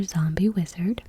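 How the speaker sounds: ordinary delivery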